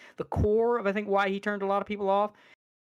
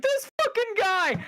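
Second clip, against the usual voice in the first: high, drawn-out mock exclamation; mock outraged delight; 14 semitones higher, slower than usual. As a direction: imitate a streamer cracking up in disbelief. Imitates streamer laughing at the clip.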